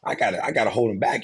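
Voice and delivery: a boss grumbling to himself; imitating the boss. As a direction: act out a greedy boss who won't let go.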